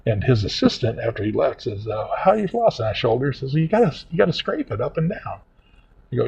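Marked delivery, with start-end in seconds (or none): 2.2-2.8 imitated assistant, much higher pitch; retold question in a raised voice; dental assistant questioning. 3.5-5.4 lightly imitated assistant, higher pitch; retold instruction in a raised voice; dental assistant instructing.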